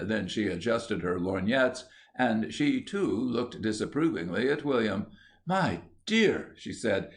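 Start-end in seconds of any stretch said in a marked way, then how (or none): 5.5-6.5 a higher, lightly voiced prim visitor; prim lady. A narrator's reading. Visitor chides the artist, a touch prim. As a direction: draw out dear with mild reproach. Visitor begins her objection.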